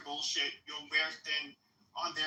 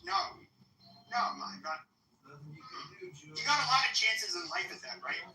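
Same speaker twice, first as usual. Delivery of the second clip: ordinary talk, nothing marked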